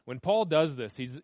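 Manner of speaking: ordinary talk, nothing marked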